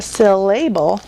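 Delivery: Syllable said as sil-LAY-bull; Syllable with a long A in the middle; long-vowel mispronunciation. Shown as a demonstration. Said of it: "always says its name".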